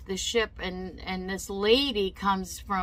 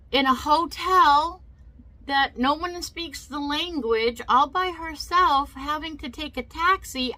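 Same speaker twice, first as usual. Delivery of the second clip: higher, insistent pleading refusal; retold protest; 6 semitones higher, 4 dB louder than usual. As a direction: protest it point by point, voice raised. Retells own protest amid the yelling.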